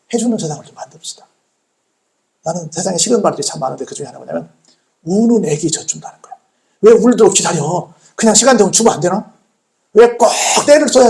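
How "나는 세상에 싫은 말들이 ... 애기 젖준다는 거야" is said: usual speaking voice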